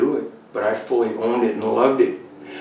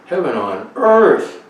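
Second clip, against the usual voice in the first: joyful exclamation; 5 semitones higher, 7 dB louder than usual. Real, not acted. Exclaiming joy at the sight.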